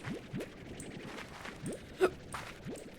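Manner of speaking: breathy mock gasp of shock. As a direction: gasp as if scandalised. Reacting to animals' reaction.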